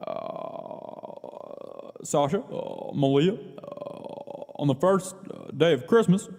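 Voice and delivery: slow, halting Barack Obama voice. Retold or imitated. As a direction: pause like Obama at a podium. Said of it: "I'll do Barack Obama".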